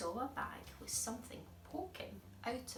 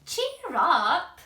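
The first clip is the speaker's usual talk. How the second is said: high, loud cheerful giant call; cheery giant; 8 semitones higher, 18 dB louder than usual. Acted. George cheers up the cold fox, loud and bright. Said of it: "said George".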